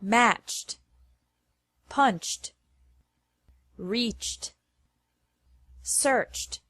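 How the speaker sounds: "Matched, punched, reached, searched" ending in a clean T, no extra syllable. Shown as a demonstration. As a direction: clip each word off with a T. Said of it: "after verbs ending with a C H".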